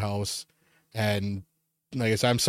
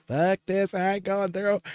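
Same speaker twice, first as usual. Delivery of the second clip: tough-guy quote; 6 semitones higher than usual. Retold or imitated.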